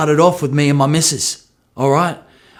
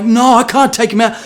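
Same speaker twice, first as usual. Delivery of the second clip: higher, louder panicked protest; panicked refusal; 10 semitones higher, 4 dB louder than usual. Retold or imitated. Midwife asks him to deliver, panicked higher refusal. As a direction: protest like a nervous new father. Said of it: "I'm like".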